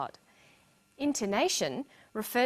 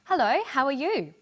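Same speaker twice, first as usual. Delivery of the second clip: bright greeting, higher and louder with big pitch swings; happy; 6 semitones higher, 5 dB louder than usual. Shown as a demonstration. Teacher showing students how someone can sound happy. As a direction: sound happy saying hello. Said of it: "Someone can sound happy".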